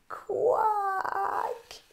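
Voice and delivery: a tired little quack; weary duck quack. Acted. Duck's reply.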